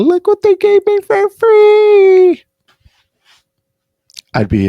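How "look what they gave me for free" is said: squealing falsetto, drawn out and loud; mock girlfriend voice. Jealous man mimics his girlfriend showing off a free McFlurry, mock squeal. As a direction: imitate a partner gushing in a very high singsong squeal. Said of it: "talking about".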